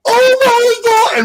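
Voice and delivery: shrieked high and loud, drawn out; a sister's stunned, slow shriek; high, loud, stretched-out gasp of shock; shocked cry. Retold or imitated. Sister hears the news.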